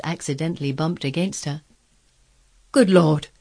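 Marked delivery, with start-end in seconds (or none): 2.7-3.3 loud, shocked housekeeper; loud burst of astonishment; startled exclamation. A narrator's reading. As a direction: cry out in alarm at the sight. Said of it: "exclaimed poor Missus Medlock".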